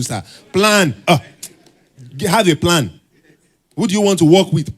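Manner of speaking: high, loud, exasperated preaching; passionate shouting. Real, not acted. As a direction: plead with them at full force, for God's sake. Pleading audience to make plans.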